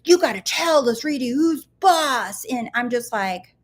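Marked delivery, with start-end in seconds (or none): 0.0-2.4 hammed-up mock-intense bossy voice, pitched high; over-serious. Speaker mocking their old serious self's rules, hammed-up intensity. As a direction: imitate a forceful drill of orders, high and pushy. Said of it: "I used to be so serious".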